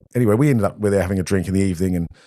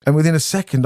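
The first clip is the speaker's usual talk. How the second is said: ordinary talk, nothing marked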